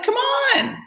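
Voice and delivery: high, incredulous come on; exasperated come on. Retold or imitated. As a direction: scoff in disbelief. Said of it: "I'm like".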